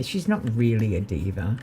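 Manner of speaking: usual speaking voice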